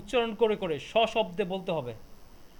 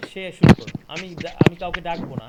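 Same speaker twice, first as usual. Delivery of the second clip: loud call to get students started; loud, urging the class to begin; 13 dB louder than usual. Real, not acted. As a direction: raise your voice to rally the class. Teacher calls class to start.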